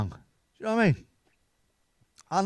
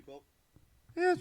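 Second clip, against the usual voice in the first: usual speaking voice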